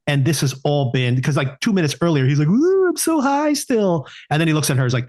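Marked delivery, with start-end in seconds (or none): 2.5-4.1 high, slow dreamy stoner voice; stoned drawl. Retold or imitated. Imitating him bragging to her how high he still is, slow and high. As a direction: float it out, dazed and high. Friend quoting stoned character.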